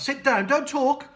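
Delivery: stern schoolteacher command; strict teacher. Acted. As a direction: snap the class to order. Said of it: "telling the class".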